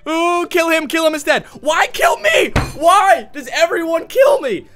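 high, loud whine of protest; indignant pleading. Real, not acted. Player complaining to attackers who all target him, indignant wail. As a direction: wail at the unfairness of it.

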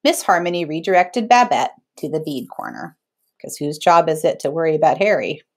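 ordinary delivery

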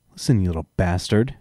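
ordinary delivery, nothing marked

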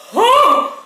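high, loud startled huh; startled yelp. Real, not acted. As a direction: yelp in shock. Reacting to heat.

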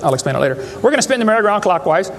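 normal talking voice

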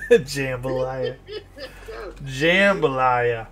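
"Jambalaya" stretched slowly, each syllable drawn out. Retold or imitated. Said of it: "that's how he said it".

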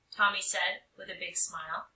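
plain reading voice

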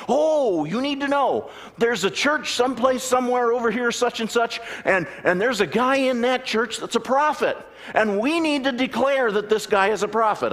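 a hammed-up breathless believer; excited congregant. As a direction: push it like urgent news. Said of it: "all fired up".

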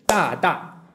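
大大 as two even beats; two evenly timed syllables, 大, 大; eighth-note rhythm. Shown as a demonstration. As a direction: sound out 大大 as a pair of equal eighth notes. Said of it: "两个八分音符就是".

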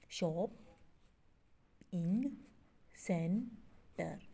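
शॉप, इंग, सेंटर in separate stretched beats; long pauses after शॉप and इंग; syllable by syllable. Shown as a demonstration. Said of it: "इसके four syllables होंगे".

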